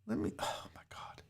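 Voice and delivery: frustrated whisper under breath. Real, not acted. Struggling with window.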